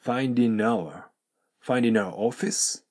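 "finding our" said as "findin-our", linked; slow model with the G of finding left out; dropped G. Shown as a demonstration. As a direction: run the N of finding straight into "our". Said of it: "これを飛ばしてNとourがくっついて".

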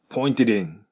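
"pointed in" as pointe-din; linked pointed in. Shown as a demonstration. Teacher models pointed-in linking. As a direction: join D to in. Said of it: "dinとなります".

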